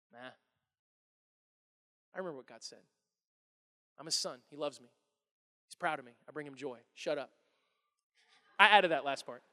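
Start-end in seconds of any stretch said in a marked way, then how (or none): 0.1-7.3 low, very soft self-assurance; quiet, settled dismissal; private inner thought. Acted. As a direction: murmur it to yourself, unbothered. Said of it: "He thought it though".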